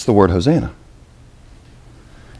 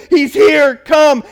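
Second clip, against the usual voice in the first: higher, louder joyful call; excited crowd shout; 6 semitones higher, 3 dB louder, much slower than usual. Retold or imitated. Pastor voicing the palm-waving crowd, shouting joyfully. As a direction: call out like a crowd greeting a king. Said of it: "they're saying".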